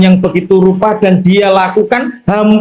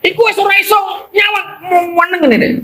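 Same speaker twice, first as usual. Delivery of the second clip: berseru heboh; 10 semitones higher, faster than usual. Acted.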